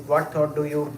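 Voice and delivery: ordinary delivery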